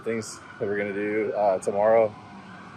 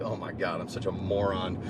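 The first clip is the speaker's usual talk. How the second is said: embarrassed mutter; 2 semitones lower, 7 dB quieter than usual. Retold or imitated. Embarrassed at himself after fist bump, soft mutter. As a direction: say it under your breath.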